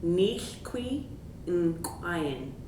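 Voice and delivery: "Nichqui" with a popped Q, rising, then "in crying" falling; popped Q sound. Shown as a demonstration. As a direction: pop the Q at the start of "Nichqui". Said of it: "get that Q sound to pop".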